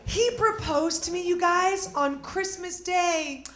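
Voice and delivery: ordinary delivery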